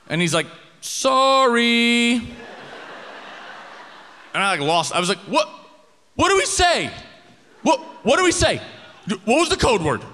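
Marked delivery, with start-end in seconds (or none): 0.8-2.1 high, loud, drawn-out sorry; high awkward sorry. Retold or imitated. Roommate's awkward apology. 5.2-10.0 high panicked yelling. Retold or imitated. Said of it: "We're, like, yelling at each other".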